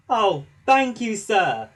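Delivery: loud, high delighted thanks; happy. A narrator's reading. Thomas thanking the Fat Controller happily. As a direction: gush your thanks. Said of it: "said Thomas happily".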